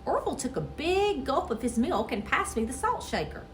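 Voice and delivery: plain reading voice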